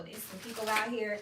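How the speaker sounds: ordinary talk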